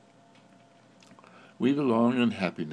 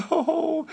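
dog's laugh; 5 dB louder than usual. Pretend. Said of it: "that's his way of laughing".

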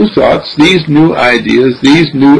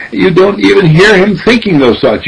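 usual speaking voice

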